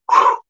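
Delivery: exaggerated blown-out sigh of relief; relieved whew. Old Davey alone on the train, relieved whew. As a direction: puff out a mock whew.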